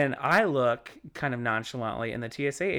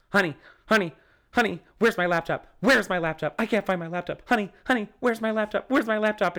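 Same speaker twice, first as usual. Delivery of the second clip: higher, louder, frantic mock wife; mock panic; 7 semitones higher, 4 dB louder than usual. Husband's wife at airport security, mock frantic panic. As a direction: imitate her panicked fit over a lost laptop. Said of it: "she starts having a goddamn conniption shit fit".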